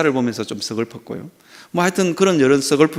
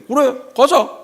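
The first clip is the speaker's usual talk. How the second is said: father agreeing; 11 semitones higher, 4 dB louder, slower than usual. Retold or imitated.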